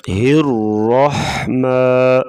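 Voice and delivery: slow "الرحمن" with ح pushed from the throat; throaty ha. Shown as a demonstration. Teacher demonstrates where ha comes from, throaty ح in "الرحمن". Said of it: "گلے سے نکالیں گے".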